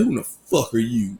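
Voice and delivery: usual speaking voice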